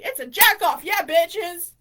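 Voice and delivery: loud blurted exclamation; brash, sudden shout; obnoxious outburst. Real, not acted. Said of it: "I keep saying obnoxious shit".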